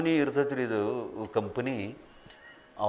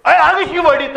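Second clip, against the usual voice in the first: sudden much higher, louder yell through a laugh; policeman's shout; 13 semitones higher, 12 dB louder than usual. Retold or imitated. Preacher voicing the policeman striking a man, laughing loud yell. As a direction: bark it out like a cop lashing out, half laughing.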